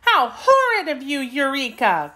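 put-on high-pitched girl scolding her kitten; indignant cry. A narrator's reading. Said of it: "cried Dorothy".

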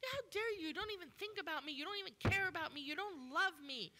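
high, loud, fast tirade; mock angry rant. Invalid man ranting angrily at Jesus, loud. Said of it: "Maybe he got angry".

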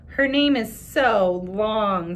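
slow, drawn-out taunt; teasing boy. Acted. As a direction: stretch it out mockingly. Joe teases.

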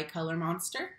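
usual speaking voice

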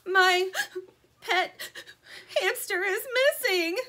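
high, loud tearful crying. A narrator's reading. Anna crying to the chickens about her hamster, tearful. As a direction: speak through crying.

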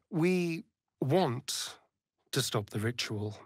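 ordinary talk

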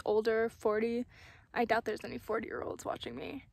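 ordinary talk, nothing marked

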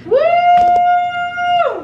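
high loud woo; excited cheer. Real, not acted. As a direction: let out a big cheer. Cheering cookies done.

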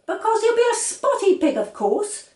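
high, loud, know-it-all; scornful child. A narrator's reading. Girl scolding her brothers, high and scornful. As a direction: talk down to silly brothers.

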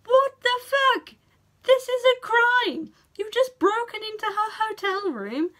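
loud, high, slow disbelieving outcry; big incredulous yell at the screen; outraged shout. Retold or imitated.

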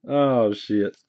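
ordinary delivery

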